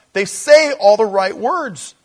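loud, slow, high pulpit declaration; preaching emphasis. Real, not acted. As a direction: drive the point home from the pulpit. Preaching rebuke.

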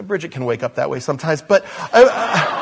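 breaking into laughter mid-sentence; real laughter while joking about his wife waking up growling; laughing. Real, not acted. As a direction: laugh through the words, losing the line. Laughing at his own joke.

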